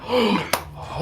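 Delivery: sharp high gasp of a crowd. Acted. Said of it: "Everyone's like".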